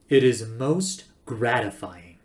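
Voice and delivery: slow, deliberate icy courtesy; cold recorded voice. A narrator's reading. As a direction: speak with cold, measured politeness. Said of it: "the voice now distinctly cold".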